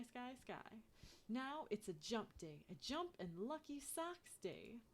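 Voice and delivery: ordinary delivery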